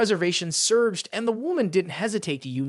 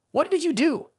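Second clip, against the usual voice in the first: high, mildly raised angry outcry; a pitched-up burst of anger at the teammate; angry shout; 7 semitones higher than usual. A narrator's reading. Wrongly hit man shouts at his teammate, high and angry. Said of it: "shouted in anger".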